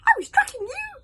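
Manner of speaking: very high, loud gleeful yell; hogfly finding Hiccup; excited shout. Acted. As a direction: shout in delight. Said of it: "shouted that hogfly".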